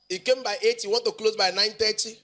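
normal talking voice

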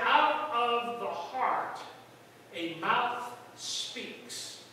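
very slow, weighty scripture; solemn quote. Acted. Preacher quoting Jesus, very slow and solemn. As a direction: lay each word down slowly. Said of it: "Jesus said".

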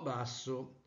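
quiet. Shown as a demonstration. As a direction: drop almost to nothing. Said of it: "il paralinguaggio è dato dal volume".

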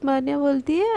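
ordinary delivery, nothing marked

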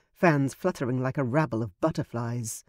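ordinary delivery

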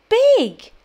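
Big said whole, high and loud; blended word. Shown as a demonstration. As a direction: say Big in one go, cheering. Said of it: "Blend the sounds together to make a word".

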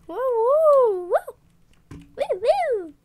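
high squealing woo cheer; excited cheering. Real, not acted.

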